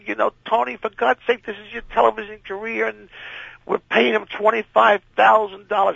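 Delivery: high, panicked rant; a boss in full alarm over his star walking off; high-pitched frantic scolding. Retold or imitated. Frantic network boss bursts in.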